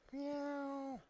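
high, soft little kitty meow; cat voice. Shown as a demonstration. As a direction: meow like a small scared kitten. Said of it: "I want everybody to say "Meow," in your best cat voice".

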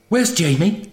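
high-pitched, anxious question; worried. A narrator's reading. Zoe asks after her missing friend, worriedly. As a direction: ask it high, with a touch of worry. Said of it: "Zoe was saying worriedly".

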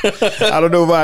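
high helpless laugh; real laughter. Real, not acted. Host cracking up over the scene, laughing. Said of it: "cracked me up".

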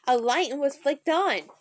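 usual speaking voice

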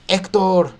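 shouting a name. Retold or imitated. Achilles calling Hector out from outside the walls, shouting. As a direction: bellow the name across the walls. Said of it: "empieza a gritar".

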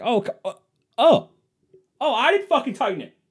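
loud, high sarcastic outburst; husband mocking the blame, loud high-pitched outburst; exasperated yell. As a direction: explode in mock disbelief.

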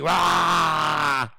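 brief fake scream; mock shriek. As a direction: yelp in mock alarm. Mock scream after the complaint.

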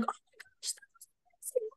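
breathless, hushed, high gasp of awe; awestruck whisper. Retold or imitated. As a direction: whisper it, overwhelmed by what you've seen. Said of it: "I start freaking out".

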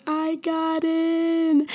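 high, long drawn-out imitated happy wail; mock tearful joy. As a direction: stretch it way up, imitating a joyful cry. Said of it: "crying tears of happiness and joy".

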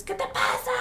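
hammed-up outraged sister, voice held back; retold mock yell. Sister heckling kids at her brother's game, mock yell. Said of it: "no te ponías a gritarles".

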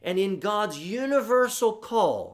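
high, loud proclamation; preaching at full force. Acted. Preacher rousing his congregation, loud and high. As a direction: drive it home to the pews.

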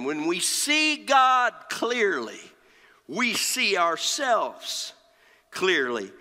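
high opening, falling to a hushed close; emphatic preaching. Real, not acted. Preacher giving the congregation the key point, emphatic preaching. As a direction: drive the point home with long pauses.

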